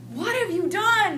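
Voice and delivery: high, loud alarmed outburst; dad freaking out. Retold or imitated. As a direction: burst out like a horrified father. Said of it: "just freaks out all over again".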